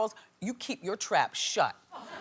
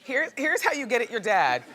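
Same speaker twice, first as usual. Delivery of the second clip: usual speaking voice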